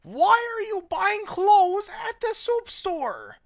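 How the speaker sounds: very high, loud, slow squeaky creature voice; suction cup voice. Acted. Streamer performing his suction cup voice for chat. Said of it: "I can't do my suction cup voice right now".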